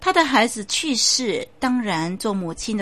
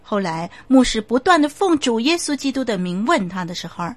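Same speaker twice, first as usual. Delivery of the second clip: ordinary talk, nothing marked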